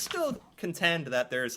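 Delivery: normal talking voice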